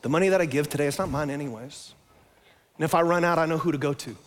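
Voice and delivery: hushed, low-voiced prayer to the Lord; quiet prayer. Shown as a demonstration. Pastor leading the congregation in prayer, quiet and low. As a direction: pray it softly, like you mean it.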